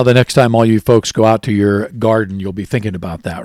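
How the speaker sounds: normal talking voice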